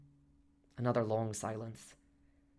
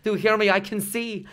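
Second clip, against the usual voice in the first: high, loud, joyful insistence; animated, elated; 9 semitones higher, 12 dB louder than usual. A narrator's reading. Molly making sure her husband hears, elated. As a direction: proclaim it loudly, overjoyed. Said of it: "I never saw her so animated".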